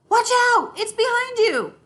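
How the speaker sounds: high, loud playful warning; warning shout. Acted. Little sister shouting a warning to her siblings, high and loud. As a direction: yell it out to warn your siblings.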